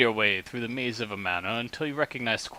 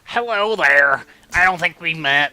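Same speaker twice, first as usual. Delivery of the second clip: nerdy greeting; 4 semitones higher, 7 dB louder than usual. Acted. GM voices the nerdy NPC.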